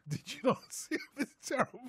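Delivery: put-on a failed Welsh accent, giggling through it; laughing Welsh accent. Host tries a Welsh accent on air and cracks up. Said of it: "I can't do it".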